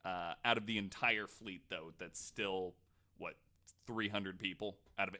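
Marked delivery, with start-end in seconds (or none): none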